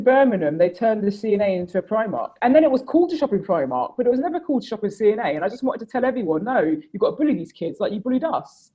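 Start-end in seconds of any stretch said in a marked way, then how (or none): 6.4-8.7 laughing through a mock rant. Real, not acted. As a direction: giggle while protesting outrageously. Jokingly demanding kids be bullied.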